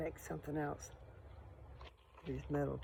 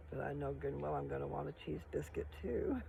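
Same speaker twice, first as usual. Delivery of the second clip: usual speaking voice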